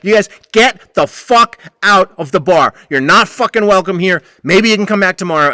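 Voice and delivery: loud, high furious shout; shouting drunks out of the bar; angry shouting. Retold or imitated. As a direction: throw them out at full volume. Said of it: "then I lost it".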